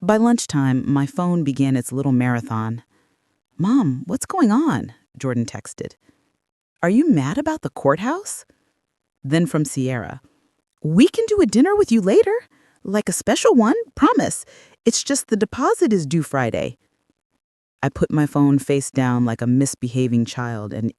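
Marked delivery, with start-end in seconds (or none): 3.6-4.9 higher, drawn-out questioning of a mother; slow, high-pitched acted query; questioning son. Son's worried text voiced by narrator. 6.8-8.4 high-pitched acted question to a mother; higher, lilting query; questioning son. Son's text voiced by narrator. 10.8-16.8 very high, sugary coaxing; high-voiced wheedling that eases down at the end; wheedling daughter-in-law. Voices daughter-in-law's wheedling text.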